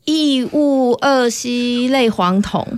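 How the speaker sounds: slowly, syllable by syllable, 异戊二烯类黄酮; carefully sounding out the long name 异戊二烯类黄酮; slow careful repeat. Shown as a demonstration. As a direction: spell out 异戊二烯类黄酮 slowly like learning a new term. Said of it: "念一次".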